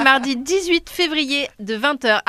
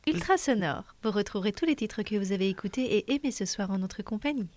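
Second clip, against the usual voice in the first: soft, quick air hostess voice; flight attendant; 5 semitones lower, 9 dB quieter than usual. Acted. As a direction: announce it like cabin crew. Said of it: "Avec la petite voix d'hôtesse".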